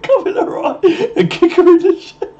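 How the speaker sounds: high-pitched giggling through the words; cracking up mid-sentence; laughing. Real, not acted. Streamer laughing at his own crude pirate joke, giggling.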